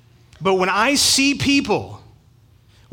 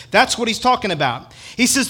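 normal talking voice

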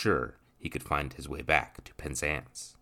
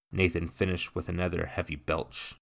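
usual speaking voice

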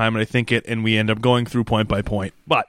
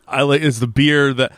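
ordinary talk

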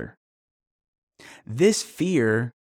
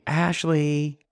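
mom voice; 2 semitones higher than usual. Retold or imitated. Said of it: "you hear the mom say".

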